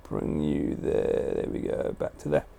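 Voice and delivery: soft whisper, slow. Real, not acted. Streamer settling the sleeping dog, slow hushed whisper. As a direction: hush it while moving the dog.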